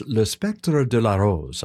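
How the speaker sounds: brushed-up French accent on the title. Shown as a demonstration. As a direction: announce the title with French pronunciation. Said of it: "brush up on your French accent".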